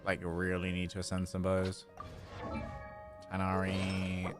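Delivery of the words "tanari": ordinary talk, nothing marked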